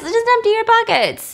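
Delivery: empty said high and loud, almost squeaky; hammed-up shrill order; captain taunting John to empty pockets, high and loud; high mock captain voice. Captain mocks, pushing John.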